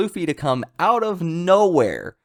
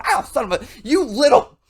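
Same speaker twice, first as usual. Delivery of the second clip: mock pained curse; 12 semitones higher, 4 dB louder than usual. Stubbing toe, cursing in pain.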